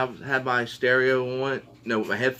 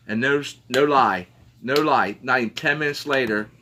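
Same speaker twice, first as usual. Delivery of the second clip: usual speaking voice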